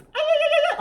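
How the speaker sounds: very high, loud trilling cry; hammed-up mariachi yelp; mock Latin whoop. Imitating her faux-Latin whooping.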